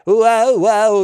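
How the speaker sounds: high, loud glam-rock whoa. Retold or imitated. Reviewer imitates [name]'s [name] inflection, high theatrical whoa. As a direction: throw out a high, swooping sung inflection. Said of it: "little, little inflection there".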